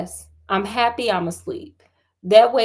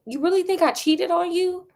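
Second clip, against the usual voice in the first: incredulous question; 8 semitones higher than usual. Acted. Acted disbelieving question.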